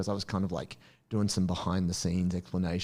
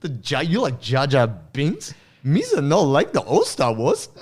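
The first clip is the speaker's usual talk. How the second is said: slow, higher, louder mock Gungan lisp; mock Jar Jar talk; 6 semitones higher, 7 dB louder, slower than usual. Teasing girlfriend who likes new Star Wars, light mock Gungan voice. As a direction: lightly ham up broken Jar Jar speech. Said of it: "It's like".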